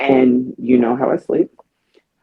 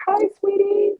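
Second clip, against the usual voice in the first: much higher, sugary hello; sweet greeting. Retold or imitated. Woman greeting the neighbor girl in her head, much higher. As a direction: greet the child like she's precious. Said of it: "I'm like".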